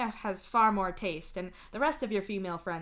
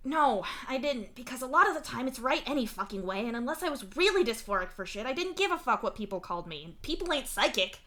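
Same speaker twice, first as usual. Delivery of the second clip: fast, higher, heated argument; arguing; 5 semitones higher, 5 dB louder than usual. A narrator's reading. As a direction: argue it out in a quick rush. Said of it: "Mituna argues".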